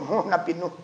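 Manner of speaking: ordinary talk, nothing marked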